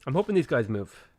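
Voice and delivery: ordinary talk, nothing marked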